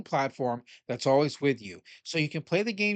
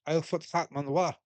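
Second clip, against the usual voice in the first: badly faked Welsh accent; horrible Welsh accent. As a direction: put on a terrible mock Welsh accent. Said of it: "That's my horrible, horrible, horrible Welsh accent".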